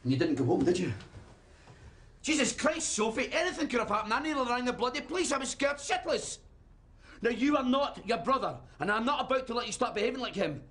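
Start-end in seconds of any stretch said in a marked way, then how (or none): 2.2-10.6 high-pitched, worked-up parental outburst; angry shouting. Acted. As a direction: let the fright burst out as scolding. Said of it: "I didn't mean to shout".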